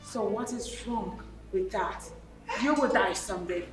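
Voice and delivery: mother dismissing her brother-in-law, cold and low; cold dismissive. Acted. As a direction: shrug off his grief coldly.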